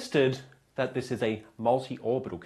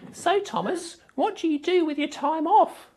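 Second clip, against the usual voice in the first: mock questioner. Imagined question to the host.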